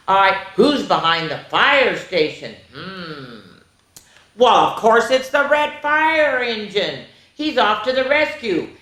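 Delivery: ordinary delivery, nothing marked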